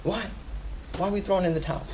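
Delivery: normal talking voice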